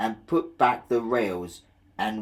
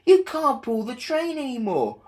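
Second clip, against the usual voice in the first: high driver voice; 13 semitones higher, 4 dB louder than usual. Acted.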